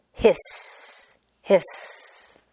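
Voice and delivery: long whispered snake hiss. Acted. The snake hissing at the animals, whispered.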